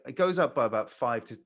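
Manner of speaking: ordinary talk, nothing marked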